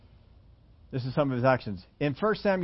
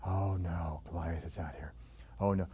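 hushed, lowered mock-scared whisper; frightened whisper; 11 semitones lower, 11 dB quieter than usual. Preacher voicing Israel's soldiers cowering from Goliath, whispered fear. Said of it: "how did Israel talk about Goliath".

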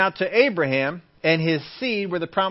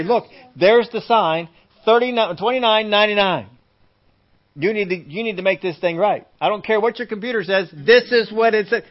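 loud, higher-pitched demand; acted indignant shopper; insistent customer; 4 semitones higher, 5 dB louder than usual. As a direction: press the complaint forcefully. Acted customer insisting on shelf price.

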